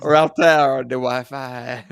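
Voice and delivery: slow, hammed-up French accent. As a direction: stretch it out in mock French. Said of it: "none of us can do a French accent".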